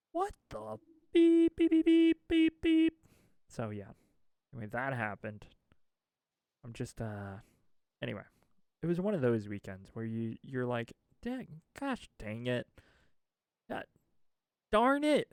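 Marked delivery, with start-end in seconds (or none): 0.1-2.9 very high, loud mock censor beeping; high censor beeps. Self-censoring swear with beeps. 11.2-15.3 frustrated cursing. Retold or imitated. Retold frustration, rising to shout.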